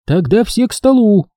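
a hint of a shout, summoning everyone; calling out. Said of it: "крикнула Нин".

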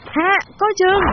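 high, urgent cry of alarm; frantic high call to watch out; desperate warning shout. Acted. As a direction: yell a warning with your last strength. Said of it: "Tôi dùng hết sức tàn còn lại hét".

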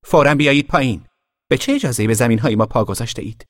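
high, loud, fast order; threatening command. A narrator's reading. Girl ordering the trespassing boy out of the tree, threatening. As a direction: point and command him down. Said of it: "به حالت تهدیدآمیزی".

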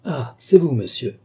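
high, loud "ah" of recognition, then dropping; startled greeting that settles quickly; surprised. A narrator's reading. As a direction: react with sudden surprise. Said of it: "dit-il avec surprise".

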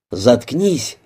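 ordinary delivery, nothing marked

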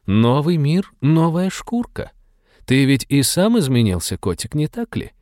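lightly voiced purring thief, teasing; a hint of a coaxing purr; playful burglar whisper. A narrator's reading. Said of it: "промурлыкала воровка".

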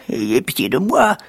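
high, pleading beggar; beggar's voice. Acted. Jack begs the giant's wife to take pity, imitated beggar voice. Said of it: "en imitant la voix d'un mendiant".

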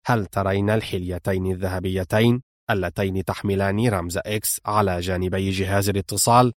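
low, soft, controlled man's voice; cool commanding villain. Acted. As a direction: keep the villain low and measured. (شرير آمر بصوت منخفض هادئ)

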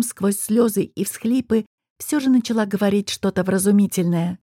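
ordinary delivery, nothing marked

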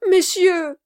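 high, loud cry for attention; exclamation. A narrator's reading. Kit, suddenly enlightened, addresses the gentlemen, exclamation. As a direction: call out to the whole room. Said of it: "s'écria Kit".

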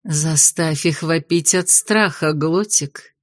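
slow, velvety witch purr. Acted. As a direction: purr it low and smooth. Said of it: "бархатным голосом произнесла ведьма".

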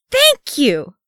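high, loud burst of relief; high-pitched exasperated cry; exasperated shout. A narrator's reading. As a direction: shout it, hands thrown up. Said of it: "Keeley shouted".

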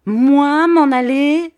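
loud high slow outraged yell; indignant cry. Acted. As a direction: bristle like a boxer ready to fight. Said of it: "s'écria Bobby". (cri indigné, très fort et aigu, lent)

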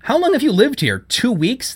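high-pitched, scornful questions; mirthless scorn. A narrator's reading. Judith belittles Zoe as a newcomer, scornful. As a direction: challenge the newcomer, pitch well up. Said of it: "Judith laughed mirthlessly".

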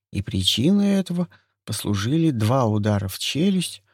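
usual speaking voice

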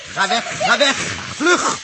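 high and very loud yell; loud frantic yell; panicked shout. Acted. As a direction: drive them off screaming. Shooing rats.

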